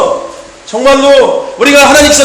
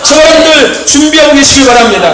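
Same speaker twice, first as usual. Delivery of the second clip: fiery preaching; 3 semitones higher than usual. Acted. Pastor urging the congregation to stay ready, fiery preaching. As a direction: preach it hard and wait for the amens.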